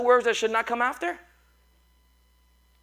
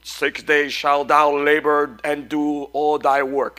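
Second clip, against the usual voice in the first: lower, slow, booming Moses voice; Moses movie voice; 9 semitones lower, 3 dB louder, slower than usual. Retold or imitated. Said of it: "sounds like Moses when he's talking in the movie".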